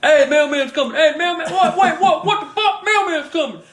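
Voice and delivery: high, loud, frantic talking-dog voice; excited dogs' voices. Retold or imitated. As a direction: yelp the alarm like a pack of dogs. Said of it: "Every dog you can think of".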